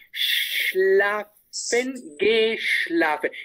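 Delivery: slow "Schlafen, geschlafen" with a long hushing "sh"; emphasised SCH. Shown as a demonstration. Teacher shows student the SCH in "Schlafen, geschlafen", long "sh". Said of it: "pronunciar la S C H un poquito más".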